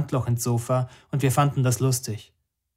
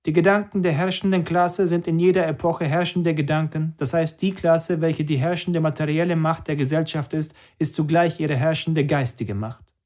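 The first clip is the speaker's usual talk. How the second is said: higher, lecturing recitation; reciting doctrine; 6 semitones higher than usual. Acted. Party member quoting Marx to drinking friends, higher lecturing tone. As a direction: recite it like a learned quotation.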